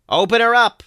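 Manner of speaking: high, loud yell; yelled command. A narrator's reading. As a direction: bellow the order to open up. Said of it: "Kennedy yelled".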